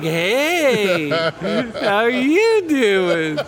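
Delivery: high, slow, laughing hello; giddy drugged greeting. Retold or imitated. Telling a friend how the painkiller hit, giddy and laughing. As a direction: float through it like the painkiller just kicked in. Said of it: "I was just happy all of a sudden".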